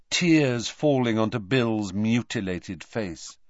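plain reading voice

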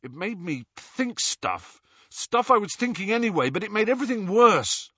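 high-pitched, agitated confession; distressed outburst. A narrator's reading. Ron backing away from the locket, in distress. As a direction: plead helplessly, pitch climbing.

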